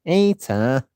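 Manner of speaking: a quick burst of Scouse; lightly put-on Scouse accent. Shown as a demonstration. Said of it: "two scouse words I know".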